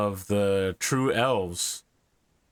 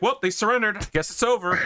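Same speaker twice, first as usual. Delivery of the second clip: imitating friend; 10 semitones higher, faster than usual. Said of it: "Bearded is gonna be like".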